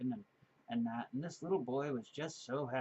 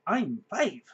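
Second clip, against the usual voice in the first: put-on loud proud little kid echo; lightly voiced proud kid; proud child; 5 semitones higher, 9 dB louder than usual. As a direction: announce it like a child. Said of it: "proudly announced".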